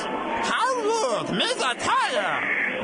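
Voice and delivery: high, drawn-out goofy alien voice; Jar Jar Binks. Retold or imitated. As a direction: squeal it out like a silly cartoon alien. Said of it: "he talks a bit like this".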